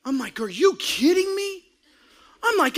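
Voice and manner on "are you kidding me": outraged disbelief. Retold or imitated. Outraged at technical foul.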